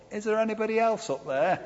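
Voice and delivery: small, high, softened voice calling up; Jack. Acted. Man on the branch seeking another rescuer, small high call. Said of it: "Jack's voice pops up".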